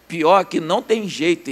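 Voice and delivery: usual speaking voice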